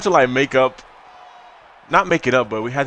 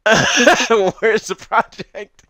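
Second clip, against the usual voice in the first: drawn-out, louder, higher mock question; mocking quote; 10 semitones higher, 6 dB louder, much slower than usual. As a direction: stretch it out, teasing your friend. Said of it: "you're like".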